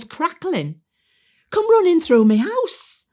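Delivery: loud, high-pitched comic alarm; high drama. Acted. Resident describing the army's arrival, loud and dramatic. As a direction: belt out the tale, stretching the key words.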